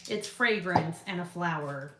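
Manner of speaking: usual speaking voice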